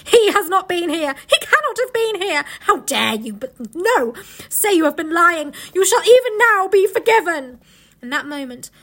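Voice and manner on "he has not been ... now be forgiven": raised, indignant denial; outraged villainess losing her composure; raging witch. A narrator's reading. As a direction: build to a loud, high tirade as the witch. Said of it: "roared the witch".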